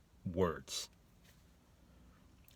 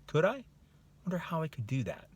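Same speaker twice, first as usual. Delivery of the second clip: higher-pitched musing with rising questions; tentative wondering; 8 semitones higher than usual. Acted. Coach voicing an opening of belief, wondering. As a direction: think aloud, newly curious. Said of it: "a little opening of belief".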